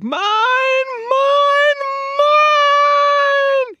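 very high, wailing possessive shriek; screaming ghost. Acted. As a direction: shriek each word, the last one longest. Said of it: "she screamed".

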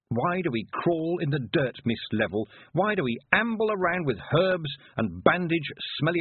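haughty, rising scornful tirade; contemptuous young witch. Acted. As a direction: sneer at the old ways, pressing harder. Tiffany possessed, contemptuous tirade.

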